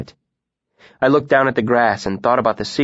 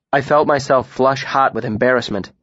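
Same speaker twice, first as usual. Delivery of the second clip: usual speaking voice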